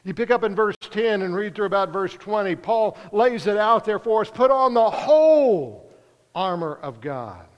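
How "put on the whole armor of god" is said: slow, leaning hard on "whole" and "armor"; stress on whole and armor. Shown as a demonstration. Preacher to congregation, reading Paul, "whole" and "armor" weighted slowly. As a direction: press down on "whole" and "armor", unhurried. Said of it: "Put some emphasis on the words whole and armor".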